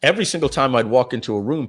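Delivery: ordinary talk, nothing marked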